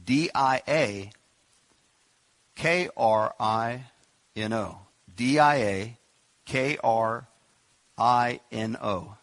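slow letter-by-letter spelling. Shown as a demonstration. Teaching the congregation the Greek word, spelling slowly. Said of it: "Here's the spelling".

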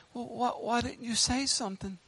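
soft, small questioning voice; hushed, hesitant little plea; child's reproach. Acted. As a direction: ask it very quietly, like a let-down child. Voices children asking the preacher.

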